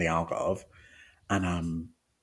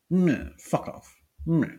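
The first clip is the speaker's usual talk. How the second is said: slow, higher bratty dismissal; bratty meh; 9 semitones higher, slower than usual. Retold or imitated. Describing brat behaviour, slow bratty meh. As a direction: drag it out like a sulky brat. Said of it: "but it's like a".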